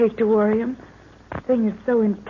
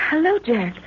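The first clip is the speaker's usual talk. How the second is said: ordinary delivery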